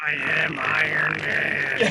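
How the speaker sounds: slow booming robotic voice through a glitching mic. Real, not acted. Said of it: "a little Optimus Prime-y".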